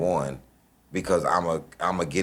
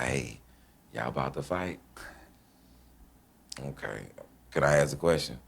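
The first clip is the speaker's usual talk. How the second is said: sarcastic; 3 semitones lower, 4 dB quieter than usual. Retold or imitated. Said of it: "I was so sarcastic".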